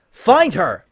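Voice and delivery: loud, high commanding shout. Acted. Celestia shouts her order at the spies. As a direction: bark the order. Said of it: "she shouted".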